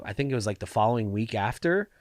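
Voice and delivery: usual speaking voice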